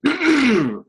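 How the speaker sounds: normal talking voice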